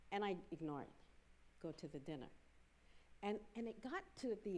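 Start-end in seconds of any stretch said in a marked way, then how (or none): 1.6-2.3 low, soft insistent urging; a quiet voice that won't let go; nagging inner voice. Retold or imitated. God's voice nagging him to the dinner, low and soft.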